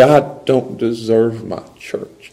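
slow, quietly humble; humble gratitude. Shown as a demonstration. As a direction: let it sink in slowly, gratefully. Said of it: "you put on the grace lens".

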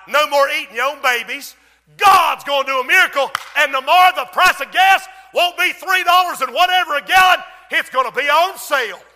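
loud, high proclamation of a miracle; full-force preaching. Acted. Preacher proclaims the prophet's promise of bread, full-force preaching. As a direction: shout the promise out to the congregation.

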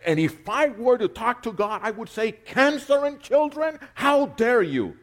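high, loud, outraged imitation; mock angry atheist. Preacher mimicking an angry atheist, high and loud. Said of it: "he gets angry and says".

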